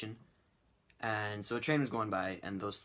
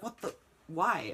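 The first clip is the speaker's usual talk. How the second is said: baffled; 5 semitones higher than usual. Retold or imitated. Narrator reacts to the dancing.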